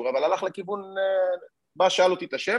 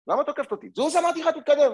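high-pitched, raised voice climbing into commands; heated retold quarrel; 11 semitones higher, 3 dB louder than usual. Arrested man and policewoman clash, heated re-enacted argument.

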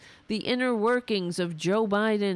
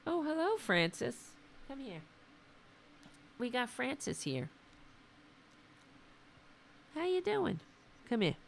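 higher, much softer coo to the cat; cooing pet talk; 6 semitones higher, 8 dB quieter than usual. Real, not acted. Streamer softly calling his cat Francis over. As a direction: coax the cat over gently.

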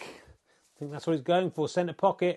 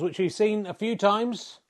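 normal talking voice

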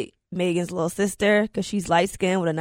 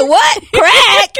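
higher, laughing, louder "What? Crack?"; shocked disbelief; 10 semitones higher, 7 dB louder than usual. Retold or imitated. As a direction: replay the moment you heard it, half laughing in disbelief. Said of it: "And I said".

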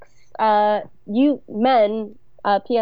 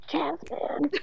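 breathy whispered hipster aside; whispered jazz cool; 6 semitones higher, 9 dB quieter than usual. Pretend. Mock jazz lover aside, whispered.

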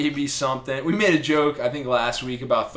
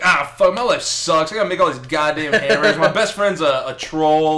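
louder, fast mock griping; griping dwarf; 2 semitones higher, 6 dB louder than usual. Dwarf moans about making hammers, loud fast gripe. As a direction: rant like an overworked dwarf. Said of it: "this dwarf's like".

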